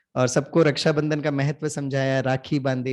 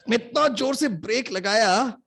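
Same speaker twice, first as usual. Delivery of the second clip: ordinary talk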